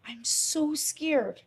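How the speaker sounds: slow, unvoiced frightened whisper; scared whisper. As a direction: breathe the words out in fear. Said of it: "You want me to act it out?".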